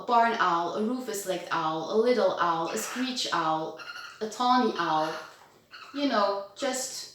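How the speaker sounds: normal talking voice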